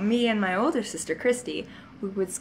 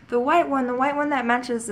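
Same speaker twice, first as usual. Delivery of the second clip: higher, louder insisting; insistent child; 5 semitones higher, 4 dB louder than usual. Retold or imitated. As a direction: press the point like an upset kid.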